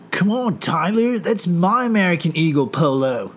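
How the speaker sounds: high, whiny douchey bro; whiny frat brother. Retold or imitated. Skylar whining at Tyler over polo, high and whiny. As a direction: complain like a spoiled preppy brother. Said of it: "douchey brothers".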